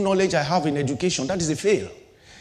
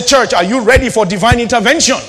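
loud call to the congregation; rising preacher's cry; preacher's shout; 5 semitones higher, 8 dB louder than usual. Real, not acted. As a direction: shout it out to the church. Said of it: "I say".

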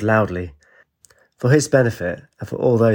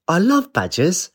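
excited; 9 semitones higher than usual. Acted. Girl jumps for joy.

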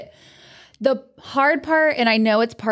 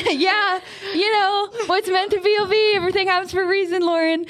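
breezy platitudes; 7 semitones higher than usual. Retold or imitated. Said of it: "And I'm like".

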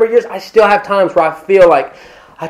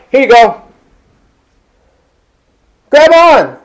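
louder, higher call to grab on; calling out help; 7 semitones higher, 5 dB louder than usual. Pretend. Helper calling to a drowning person, loud and urgent. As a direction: reach out and shout grab on. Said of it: "and you're like".

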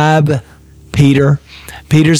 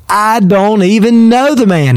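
Peter's oath, pitched higher and pushed harder; vehement denial; 6 semitones higher than usual. Acted. Peter swearing to the servants that he doesn't know Jesus, a heated oath. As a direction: swear it out, denying him. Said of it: "this time with an oath".

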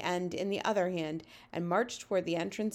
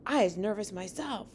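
plain reading voice

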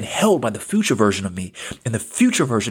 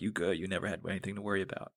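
very soft, low, quick murmur; hushed, low, comforting aside; quiet reassurance; 4 semitones lower, 16 dB quieter, faster than usual. Acted. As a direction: drop right down to comfort your younger self. Reassuring his younger self.

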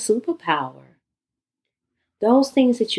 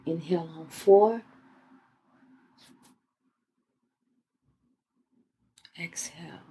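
guided meditation. Acted. Leads guided breathing exercise.

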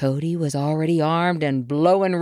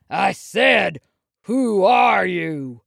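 slow, higher, insistent demand; raised voice; 4 semitones higher, 3 dB louder, slower than usual. A narrator's reading. Bear ignored by the newcomer, raised insistent voice. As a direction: lean hard into a stretched-out repeat. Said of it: "Buck spoke louder".

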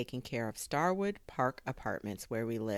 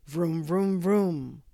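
loud, raised "vroom" engine imitation; race car engine noise; 4 semitones higher, 9 dB louder, much slower than usual. A narrator's reading. Drone flying off like a race car, loud revving. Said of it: "sounded like a race car".

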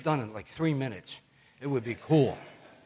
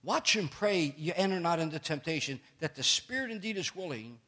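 raised, measured King James reading voice; scripture reading; 4 semitones higher than usual. Acted. Preacher reading Gethsemane to the congregation, solemn scripture reading. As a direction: read the Gospel with weight and rising feeling.

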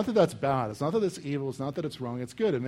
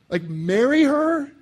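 much higher, louder, slow incredulous squeal; hammed-up horror at marrying a widow; mock shriek of disbelief; 11 semitones higher, 7 dB louder, slower than usual. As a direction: shriek it like the idea is absurd. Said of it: "You'd be like".

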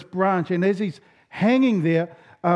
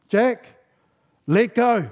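lightly acted God, higher-pitched and firm; commanding God; 5 semitones higher, 4 dB louder, slower than usual. God tells the hanging man what to do, lightly acted command. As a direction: give a measured command from above.

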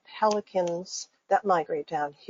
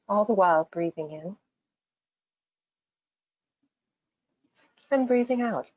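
guided meditation. Acted. Faculty member guiding listeners through breathing and imagery, calm meditation voice. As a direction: lead listeners gently into imagery, phrase by phrase.

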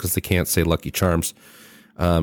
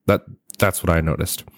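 ordinary talk

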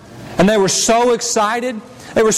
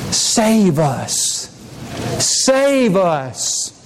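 lower, hushed plea; pleading cry; 6 semitones lower, much slower than usual. Acted. Preacher voicing the oppressed crowd begging to be saved. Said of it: "they cried out".

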